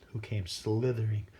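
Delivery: usual speaking voice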